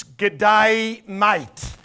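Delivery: broad Aussie g'day mate, teaching it; Australian greeting. Shown as a demonstration. Preacher teaching the crowd an Aussie greeting, broad Australian. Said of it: "this is what we will say in Australia".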